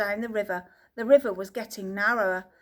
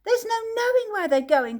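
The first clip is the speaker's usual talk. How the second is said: crying out gleefully; 11 semitones higher, 5 dB louder than usual. A narrator's reading. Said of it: "cried Mister Wonka, hooting with laughter".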